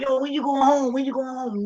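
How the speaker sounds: high-pitched, pestering plea; imitated begging.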